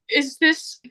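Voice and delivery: high voice of an upset caller; high-pitched distraught woman. Retold or imitated. Distraught stranger phones about a missing man, high-pitched. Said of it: "She's got a high-pitched voice".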